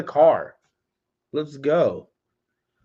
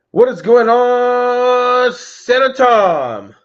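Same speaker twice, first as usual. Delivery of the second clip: high, slow, loud call-out; greeting Santa Tom as he joins the live, loud and drawn out; 11 semitones higher, 8 dB louder, much slower than usual. Real, not acted. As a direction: shout a slow greeting across the room. Greeting a viewer joining live.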